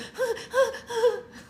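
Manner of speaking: high whimpering puppy cry; imitating the baby's saddest puppy cry; puppy-like cry imitation. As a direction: squeak out the baby's sad little cry. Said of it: "She goes like".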